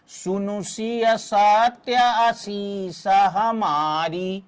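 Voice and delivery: drawn-out, measured recitation of a chaupai; slow verse recitation. Shown as a demonstration. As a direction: stretch each syllable of the verse. Teacher recites chaupai to show matras. (चौपाई का धीमा, खिंचा हुआ पाठ)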